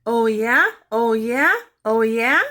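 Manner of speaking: loud, high, cheeky parrot taunt; sassy parrot. Acted. Parrot loudly sassing Mary's mother. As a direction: squawk it back, rising each time. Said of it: "Penelope blinked her eyes and said".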